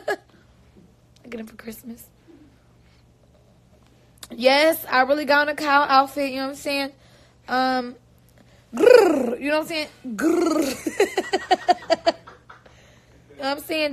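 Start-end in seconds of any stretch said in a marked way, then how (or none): 0.1-2.1 giggling whisper, slow; laughing whisper. Real, not acted. Laughs while whispering happy news. 8.7-9.4 mock animal growl, louder; playful growl. Growls about her outfit. 10.1-12.1 mock growl dissolving into a laugh; playful growl.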